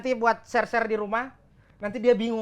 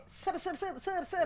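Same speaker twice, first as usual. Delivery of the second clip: mock echo; 8 semitones higher, 9 dB quieter, slower than usual. Shown as a demonstration. Demonstrates an echo effect idea.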